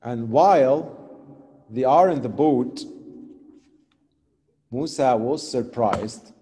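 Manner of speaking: usual speaking voice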